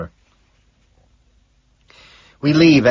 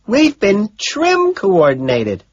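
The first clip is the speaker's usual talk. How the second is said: slow, high yell falling at the end; outraged shout, stretched out; 11 semitones higher, 6 dB louder, slower than usual. A narrator's reading. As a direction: announce it in a loud, drawn-out cry. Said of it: "Evelyn shouts".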